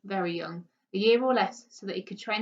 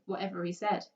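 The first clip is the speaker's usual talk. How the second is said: plain reading voice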